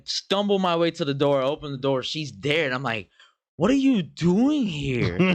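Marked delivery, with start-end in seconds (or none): 3.6-5.4 put-on high, drawn-out drunken bewilderment; slow, high-pitched disbelief; incredulous question. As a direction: reenact shock at an unexpected visitor. Retold drunken shock at door.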